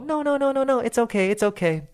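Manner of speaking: put-on high, shy child's refusal; a bashful kid politely turning the offer down; shy boy. The shy boy politely declines the invitation, high child voice. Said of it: "很不好意思的就说".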